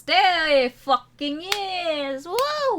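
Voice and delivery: slow, loud, fed-up outburst; loud exasperated shout. Real, not acted. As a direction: drag it out loud in disbelief. Player calls out the racism.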